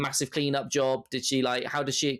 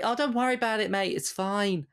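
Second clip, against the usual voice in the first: put-on breezy, higher Australian accent, no worries mate; cheerful, higher-pitched Australian reassurance; 5 semitones higher than usual. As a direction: shrug it off like a laid-back Australian. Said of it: "I went Australian there".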